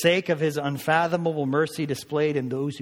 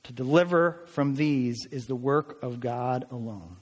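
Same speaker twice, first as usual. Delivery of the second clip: slow, weighty quotation; solemn slow reading of a commentator; slow quote read; slower than usual. Real, not acted. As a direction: lay each phrase down deliberately. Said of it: "And he said this".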